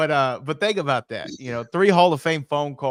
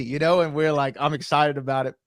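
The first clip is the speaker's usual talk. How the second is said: usual speaking voice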